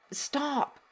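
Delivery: soft, high, hushed stop; gentle stop. Shown as a demonstration. Coach telling guilty parent to stop, hushed and kind. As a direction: softly halt the self-blame. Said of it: "Give yourself some grace".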